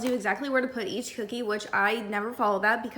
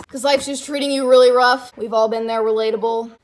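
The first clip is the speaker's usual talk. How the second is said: loud rant; 12 dB louder than usual. Real, not acted. Rant about stress while chopping.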